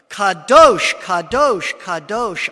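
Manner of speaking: loud ringing call, each Kadosh falling away; angelic proclamation. Acted. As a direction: call it out like the seraphim across the temple. Angels calling to one another.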